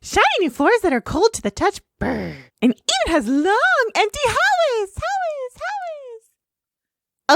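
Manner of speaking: very high, fast, echoing pony voice; high echoing cartoon voice. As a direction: echo the words like an empty hall. Voicing the castle's emptiness.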